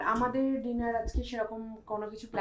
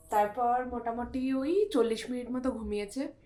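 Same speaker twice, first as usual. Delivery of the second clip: usual speaking voice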